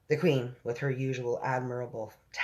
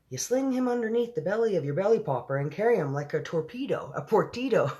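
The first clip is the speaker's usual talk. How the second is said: higher-pitched storybook giant explaining; lightly voiced giant; 7 semitones higher than usual. A narrator's reading.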